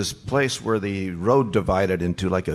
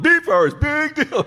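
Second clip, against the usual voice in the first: high, sneering heckler voice; mock them from the crowd; sing-song put-down; mocking heckle. Heckler repeating her jeer.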